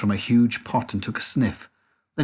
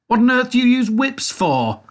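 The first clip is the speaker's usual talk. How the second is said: very high, loud demanding question; indignant girl; 12 semitones higher, 6 dB louder than usual. A narrator's reading. Veruca questions Wonka, high and indignant. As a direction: demand it like a spoilt child.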